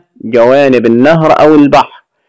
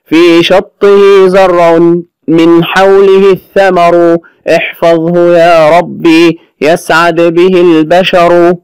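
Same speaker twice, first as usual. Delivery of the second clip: slow, raised sung-like recital of verse; poem recitation; 4 semitones higher, slower than usual. Acted. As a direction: chant each line slowly like a school anthem.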